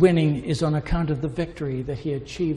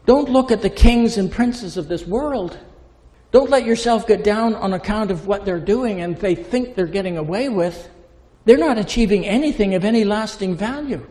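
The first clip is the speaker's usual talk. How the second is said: raised, emphatic rebuke as Paul; voicing Paul telling them off; Paul insisting, louder; Paul, forceful; 5 semitones higher, 5 dB louder than usual. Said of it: "But Paul says".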